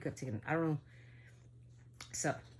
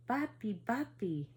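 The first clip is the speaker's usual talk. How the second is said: put-on a slow, higher, uncanny little-kid voice; eerie child voice; 7 semitones higher, slower than usual. Demon child on the horse calling to grandpa, eerie childlike voice. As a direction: call papi like something pretending to be a child. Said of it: "this weird, like, disgruntled type of voice".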